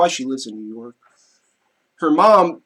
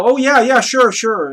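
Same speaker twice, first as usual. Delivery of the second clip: higher, louder imitation of an agreeable aunt; ready agreement; 7 semitones higher, 7 dB louder, slower than usual. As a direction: imitate an aunt readily saying yes. Acting out aunt's ready agreement.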